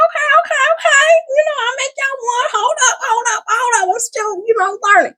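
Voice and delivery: high, hurried, overwhelmed reply; flustered self. Retold or imitated. Answering her family's demands, high and flustered. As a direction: plead for patience in a high squeak. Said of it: "And I'm like".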